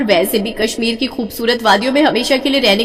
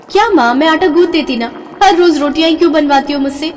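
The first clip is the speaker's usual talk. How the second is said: whiny protest; 6 semitones higher than usual. Acted. Daughter whining at mother.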